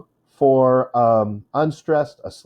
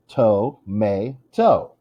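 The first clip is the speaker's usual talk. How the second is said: slow "To-may-to" in three separate beats; "To-may-to" sounded out slowly; syllable by syllable; much slower than usual. Shown as a demonstration. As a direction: split "To-may-to" with pauses between syllables. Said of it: "has three syllables".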